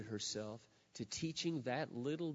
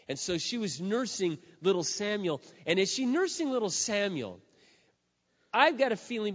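louder and higher, rising fervour; preaching at full force; 8 semitones higher, 8 dB louder than usual. Acted. Preacher picturing Hannah nursing Samuel, fervent. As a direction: lift the congregation with it.

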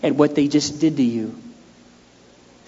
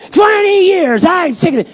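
much higher and louder exasperated cry; fed-up outburst; 7 dB louder than usual. Retold or imitated. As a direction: burst out, hamming up the frustration. Exaggerated fed-up inner rant.

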